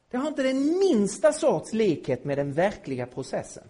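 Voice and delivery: ordinary talk, nothing marked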